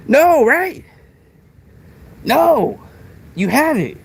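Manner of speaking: high-pitched, emphatic no; defiant, high-pitched rebuttal; emphatic denial. Real, not acted. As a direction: throw the denial back, voice jumping high. Defiant answer to own taunting question.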